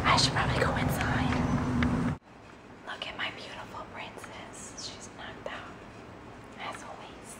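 hushed whisper so the neighbors won't hear; soft unvoiced whisper. Real, not acted. Neighbors watching, daughter asleep.

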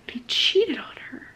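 hushed whisper of disbelief. Real, not acted. To viewers about the cheating, whispered. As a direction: breathe it out unvoiced. Stressing his betrayal.

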